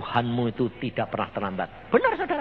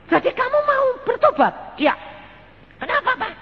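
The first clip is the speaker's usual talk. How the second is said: much higher, louder, put-on wife's voice; mock surprised wife; 5 dB louder than usual. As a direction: play the amazed wife, high and bright-voiced. Wife surprised husband repents. (suara istri yang kaget, tinggi sekali)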